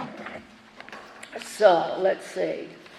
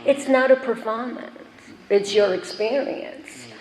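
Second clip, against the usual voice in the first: quoting the friend with a hint of New York accent; 3 semitones higher than usual. Quoting her New York friend, lightly done New York accent. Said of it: "she had that accent".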